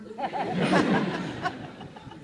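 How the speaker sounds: long breathy burst of laughter; laughing. Real, not acted. Teller amused by his childhood story, laughing. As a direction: crack up at the memory.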